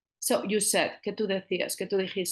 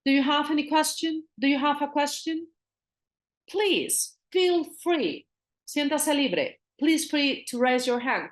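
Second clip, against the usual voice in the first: inviting host voice; 6 semitones higher than usual. Shown as a demonstration. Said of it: "does anyone have any questions".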